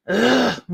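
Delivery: frustrated sigh. Real, not acted. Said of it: "I'm a little bit frustrated in myself".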